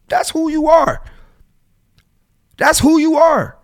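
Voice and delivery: high, loud, hammering the point home; emphatic scolding. Real, not acted. Host lecturing a flaky guy, loud and emphatic. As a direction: tell him flat out who he is.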